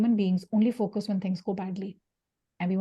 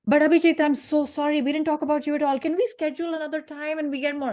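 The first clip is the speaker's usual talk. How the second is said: guest's eager apology; 6 semitones higher than usual. Retold or imitated. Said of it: "law of reciprocity".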